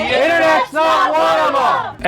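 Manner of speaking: high shouted promo tagline; shouted ad slogan. Acted. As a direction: yell the slogan like an ad.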